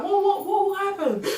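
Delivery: high, urgent what what what; mock alarm. Friend on the phone hearing bad news, high and alarmed.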